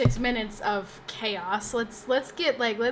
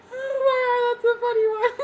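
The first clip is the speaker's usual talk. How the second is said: much higher and louder, cracking up; laughing squeal; 12 semitones higher, 6 dB louder than usual. Real, not acted. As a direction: squeak it out through helpless laughter. Laughing at own joke.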